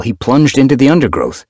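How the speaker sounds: plain reading voice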